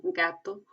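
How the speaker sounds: "gato" with hard g. Shown as a demonstration. Teacher contrasts hard G in "gato". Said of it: "suena normal como una G".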